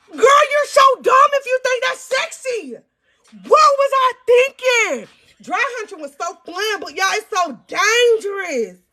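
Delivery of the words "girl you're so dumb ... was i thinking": loud, high, slow mock scolding of her younger self; self-scolding shout. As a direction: yell at your teenage self in disbelief.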